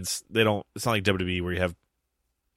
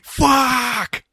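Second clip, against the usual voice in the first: mock outburst; 6 dB louder than usual. Imagining a viewer's outburst.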